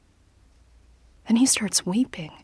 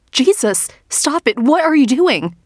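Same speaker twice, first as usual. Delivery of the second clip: higher, louder frantic yell; alarmed shout; 6 semitones higher, 7 dB louder than usual. Acted. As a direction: shout at him to stop. Narrator fights off Rob.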